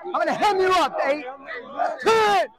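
high, repeated coach's yell; urgent shouted order. Real, not acted. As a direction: shout at players to move now. Coach ordering players to clear.

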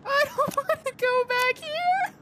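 scared laughing squeal. Real, not acted. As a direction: laugh it out in a high panicked squeal. Repeats line while laughing scared.

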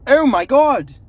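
sudden high, loud shout of shock; startled yell. Real, not acted. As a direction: blurt it out as if startled in the dark. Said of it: "that was terrible".

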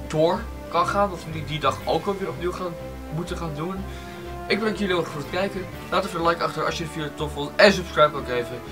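normal talking voice

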